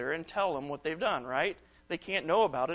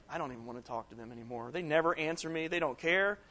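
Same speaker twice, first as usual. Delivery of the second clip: sulky mutter. Retold or imitated. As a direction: grumble it under your breath. Sulky reaction to no reply.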